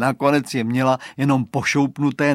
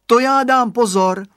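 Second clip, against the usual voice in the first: put-on high, loud child's promise; cheerful high-voiced assurance; eager little boy; 11 semitones higher, 10 dB louder than usual. A narrator's reading. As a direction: pipe up eagerly like a small boy. Boy assures his mother.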